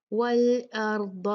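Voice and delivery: slow "वल अर्द" with thick r and d; "वल अर्द" recited full-mouthed; heavy letters. Shown as a demonstration. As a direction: darken "र" and "द" in "वल अर्द". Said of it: "रा heavy होगा".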